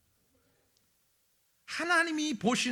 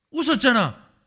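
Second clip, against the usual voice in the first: louder "웃었잖아" with a falling end; firm insistence; 3 semitones higher, 13 dB louder than usual. Acted. Preacher as God pinning Sarah down, louder. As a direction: state it flatly and firmly.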